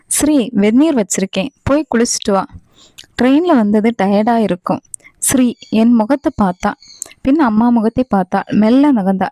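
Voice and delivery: usual speaking voice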